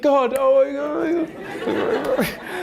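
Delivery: very high and loud, slow, laughing through it; hammed-up mother in joyful disbelief; overjoyed laughing mum. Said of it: "she's like".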